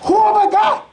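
high, pleading cry of a man abandoned; despairing king. Acted. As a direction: voice David crying out, alone and betrayed. David's despair voiced in sermon.

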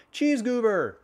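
high, loud squawking cry; pigeons shouting. Acted. Hungry pigeons shouting for the cheese goober. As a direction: shriek it like a greedy pigeon.

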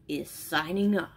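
usual speaking voice

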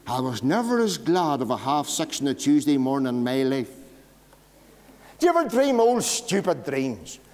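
usual speaking voice

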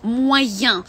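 "Moyen" ending in a nasal "ян"; French nasal. Shown as a demonstration. Teacher models "Moyen" for the class. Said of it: "друге слово moyen".

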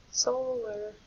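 SOLAR with long O, as soh-lar. Shown as a demonstration. Teacher confirming the answer, long-O pronunciation. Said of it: "will be a long".

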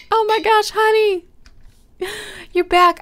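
high, loud and delighted; excited greeting. Real, not acted. Gamer greeting the cat as Honey returned, high and happy. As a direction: greet the cat like a lost friend.

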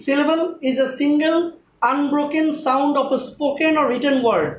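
slow, measured dictation of a rule; teacher dictating slowly; slow careful definition. Shown as a demonstration. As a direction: state the definition slowly, phrase by phrase. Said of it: "সবাই আগে মনোযোগ দিয়ে শুনো".

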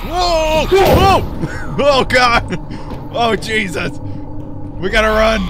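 panicked high yells; scared horror game streamer. Real, not acted. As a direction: scream it, fleeing in terror. Monster appears, they flee.

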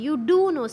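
forceful "do"; emphatic do. Shown as a demonstration. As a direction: stress "do" firmly in "You do know". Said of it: "See, I'm emphasizing".

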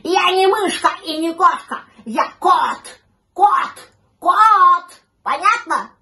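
high, loud outraged shout; furious cat setting them straight; indignant cat yelling. A narrator's reading. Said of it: "закричал возмущённый кот".

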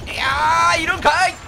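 loud startled outcry; surprised shout. Real, not acted. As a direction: shout it out, caught off guard. Surprised enemy present.

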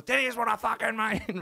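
loud, high-pitched Aussie bluster; hammed-up furious insistence; mock outraged shout. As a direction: shout it like an incensed bloke in a story. Acting Colin's angry reply.

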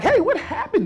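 high, surprised friend voice. Retold or imitated. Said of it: "they're like".

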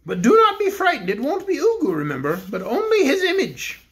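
the Bear King addresses the cook; kindly Bear King. Acted. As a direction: speak as a gentle storybook king. King speaks.

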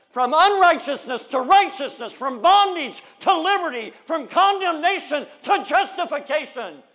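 loud, slow, building pulpit cry; loud preacher's cadence; preaching. Acted. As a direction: thunder it from the pulpit. Preaching at full force.

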